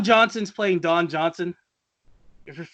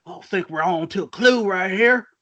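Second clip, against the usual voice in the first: mock Southern detective; put-on drawl, cartoony Southern sleuth. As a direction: play up the Southern twang. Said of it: "every time he finds it".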